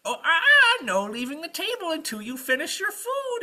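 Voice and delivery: very high, loud singsong scold; scolding witch. Acted. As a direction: chide him like a fussy mother. Witch scolds knight to stay.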